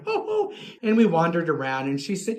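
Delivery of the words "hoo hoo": high hooting mock giggle; imitated shared laugh. Shopper and old lady laugh together, high imitated hoots. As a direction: mimic two friends hooting high. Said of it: "And we went".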